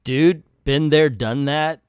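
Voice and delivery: ordinary talk, nothing marked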